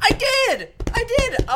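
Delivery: high, loud excited reply. Real, not acted. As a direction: gush with excitement. Excited reply about new home.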